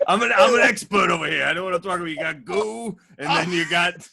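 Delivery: high, loud hammed-up know-it-all; mock pompous expert. Ribbing himself on the podcast as a smug know-it-all.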